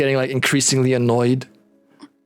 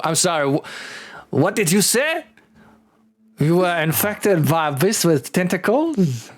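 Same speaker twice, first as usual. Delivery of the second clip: waking, intrigued character; 5 semitones higher than usual. Acted. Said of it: "Andrew kinda wakes up".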